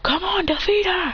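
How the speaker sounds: high, eager cheering; excited cheer. Retold or imitated. A boy cheering his friend to beat her, high and eager. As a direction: urge the teammate on. Retelling a fight, cheering a teammate.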